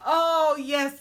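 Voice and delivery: usual speaking voice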